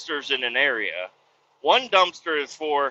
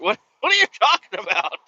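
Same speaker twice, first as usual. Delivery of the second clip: high, loud, laughing disbelief; incredulous; 8 semitones higher, 5 dB louder, faster than usual. Retold or imitated. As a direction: protest through a laugh as if it's absurd. Retelling reaction to being called princess.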